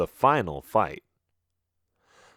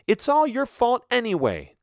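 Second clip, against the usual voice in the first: defensive. Acted. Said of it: "defending herself".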